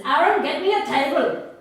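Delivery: Ruby ordering the groom, loud and sharp; commanding Ruby. Acted. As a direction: snap the command across the room. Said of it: "she stands up and says".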